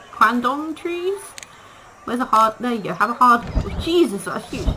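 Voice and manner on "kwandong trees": high, slow, drawn-out question; mock puzzled question. Player to the zoo animals, puzzling over the tree name, high and slow. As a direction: stretch it out high and baffled.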